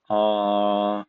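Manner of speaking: R held long and level; flat tone. Shown as a demonstration. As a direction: keep R on one even pitch. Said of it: "First, flat tone".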